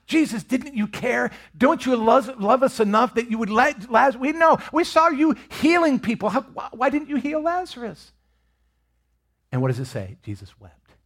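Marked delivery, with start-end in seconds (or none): 0.1-8.1 high, pleading cry of grief; desperate plea. Acted. Martha and Mary pleading with Jesus, high and desperate. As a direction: beg like a grieving sister.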